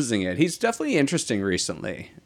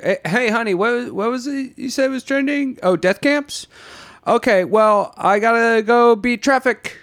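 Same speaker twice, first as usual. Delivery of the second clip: much higher, airy put-on voice of someone shrugging off horror; higher, louder mock-cheerful small talk; oblivious chatty spouse; 11 semitones higher, 3 dB louder than usual. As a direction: play a breezy, clueless partner chatting over coffee. Acting an oblivious person at home.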